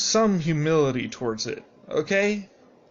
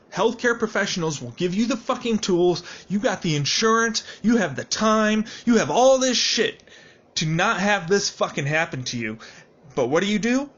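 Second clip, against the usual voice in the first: rising anger, pushing louder; real frustration building, louder on each point; getting angry; 7 semitones higher, 4 dB louder than usual. Real, not acted. Vlogger rants at people who won't seek help, getting angry. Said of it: "I'm just getting angry".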